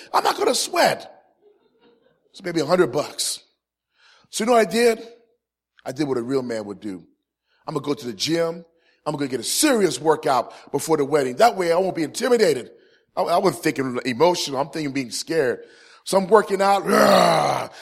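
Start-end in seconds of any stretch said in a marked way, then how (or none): 0.1-1.2 high-pitched, indignant comeback; mock-indignant denial; indignant protest. Acted protest to teasing brother. 16.9-17.7 loud, drawn-out gym roar; mock macho growl; workout roar. Imitates grunting while working out.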